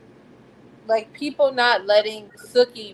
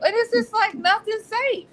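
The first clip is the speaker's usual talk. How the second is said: high, incredulous complaint; exasperated; 11 semitones higher than usual. Real, not acted. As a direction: voice pitched way up in dismay. Exasperated about dating vampires.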